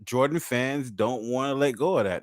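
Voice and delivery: usual speaking voice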